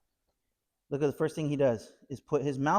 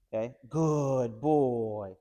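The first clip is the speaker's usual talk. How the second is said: soothing praise; 3 dB louder, much slower than usual. Real, not acted. Trainer soothing the puppy, slow gentle praise.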